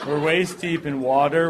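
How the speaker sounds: ordinary delivery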